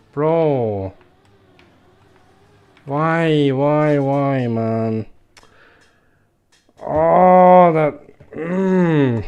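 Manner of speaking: loud frustrated yelling; exasperated, shouting at the game; angry outburst. Real, not acted. Fails same game part twice.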